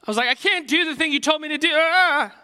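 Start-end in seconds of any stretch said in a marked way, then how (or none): 0.3-1.7 high and loud, frazzled and overwhelmed; stressed teen refusing his boss, louder and higher; panicked outburst. Retold or imitated. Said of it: "in my panic". 1.7-2.3 high, loud cry of overload; teen overwhelmed at work, loud exasperated cry; panicked exasperated yell. Retold or imitated.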